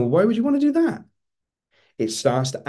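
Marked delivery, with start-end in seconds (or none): 0.0-1.0 quick, much higher-pitched incredulous question; high, rushed mock disbelief; questioning brain. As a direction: voice a baffled inner brain, pitch shooting up. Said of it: "your brain's going".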